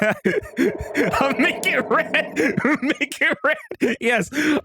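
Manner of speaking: high, slow words through giggles; giggling. Real, not acted. Giggling while changing background.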